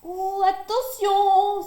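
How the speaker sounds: loud, slow high warning cry; cried warning. A narrator's reading. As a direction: call out a warning to a friend. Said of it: "s'écrient les animaux". (animaux crient pour avertir Hiawatha, voix forte)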